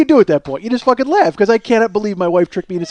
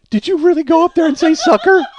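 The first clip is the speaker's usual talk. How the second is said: high, laughing disbelief; incredulous wife; 10 semitones higher, 4 dB louder than usual. Retold or imitated. Wife on the phone to her tricked husband, incredulous and laughing. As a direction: imitate a wife's high-pitched, amused disbelief.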